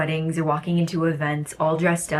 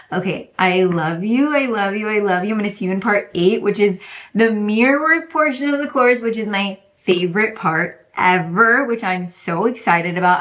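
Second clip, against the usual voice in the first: bright, higher, louder gushing love-yous; gushing goodbye; 4 semitones higher, 4 dB louder than usual. Real, not acted. Signing off to viewers, gushing with excitement. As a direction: pour out affection to the viewers.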